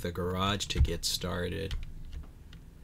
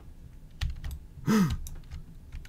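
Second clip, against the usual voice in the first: sharp gasp; 8 semitones higher, 5 dB quieter than usual. Real, not acted. Startled by something horrifying.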